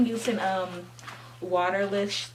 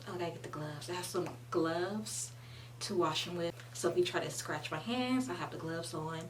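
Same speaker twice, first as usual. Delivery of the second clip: ordinary delivery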